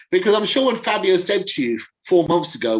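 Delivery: usual speaking voice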